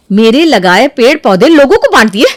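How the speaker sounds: loud, outraged repeat; angry disbelief. Acted. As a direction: throw it back at her, outraged. Second take erupts in anger. (गुस्से में ज़ोर से दोहराना)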